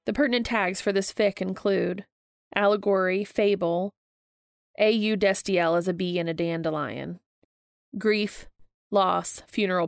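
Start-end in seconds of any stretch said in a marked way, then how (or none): none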